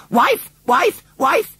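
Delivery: very high-pitched bird-like calls; repeated frantic cries; distressed crying out. A narrator's reading. Father Robin flies from bush to bush, distressed. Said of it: "in such a distressed way".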